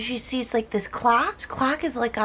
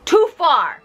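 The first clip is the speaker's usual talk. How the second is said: louder, higher too far; emphatic; 5 semitones higher, 4 dB louder than usual. Real, not acted. Reacting to the beheading, with an emphatic Too far. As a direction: push Too far harder. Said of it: "repeated louder".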